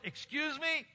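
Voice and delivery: high-pitched incredulous retort; incredulous challenge. Retold or imitated. Preacher answering claims of animal noises in the Spirit, incredulous. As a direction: push back in high-pitched disbelief.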